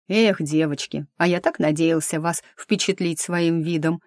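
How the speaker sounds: usual speaking voice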